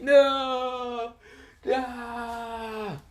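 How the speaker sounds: very high wailing no; mock crying. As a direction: wail it like the book is dying. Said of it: "and Kakashi's crying".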